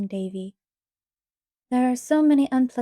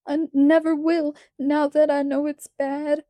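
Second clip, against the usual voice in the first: between sobs; 6 semitones higher, 4 dB louder than usual. A narrator's reading. Little boy swearing to Anne between sobs, tearful. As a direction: stammer out the vow while still crying. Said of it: "asseverated Davy between sobs".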